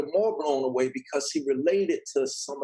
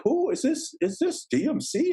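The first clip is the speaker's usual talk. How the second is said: high-pitched mock disbelief on the phone; put-on incredulous questioning, pitched way up; astonished receptionist; 11 semitones higher than usual. Label receptionist astonished by the caller.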